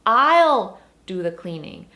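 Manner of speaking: emphatic I'll, loud and drawn out; stress on I'll. Shown as a demonstration. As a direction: insist hard on I'll. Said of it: "제가 할게요".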